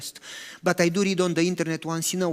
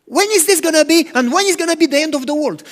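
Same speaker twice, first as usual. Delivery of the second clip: higher, louder, urgent questions; eager asking; 7 semitones higher, 6 dB louder, faster than usual. Retold or imitated. Disciples urgently asking Jesus about the end, higher and louder. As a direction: press Jesus for an answer.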